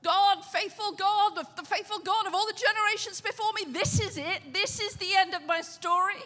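hammed-up cry to heaven before the end; desperate mock prayer.

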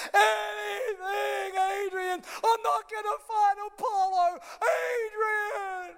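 hammed-up wailing, high and loud; drawn-out tearful bellow; Rocky. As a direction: bawl it like a desperate boxer. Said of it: "He's crying".

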